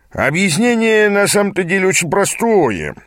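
high, drawn-up storyteller's pitch; glib mentor. A narrator's reading. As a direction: spin the tall tale with showy ease. Mentor spins a tale to youth.

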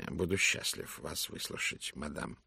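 lightly voiced gallant detective; soft courteous Poirot. (тихий учтивый голос)

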